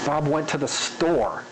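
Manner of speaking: slow line ending in a stretched "store"; varied spacing. Shown as a demonstration. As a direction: linger on "store". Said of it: "where you linger, and where you rush".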